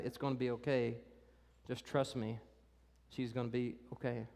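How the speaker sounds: soft, low comfort; reassuring Jesus. Retold or imitated. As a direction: gently promise it will be okay.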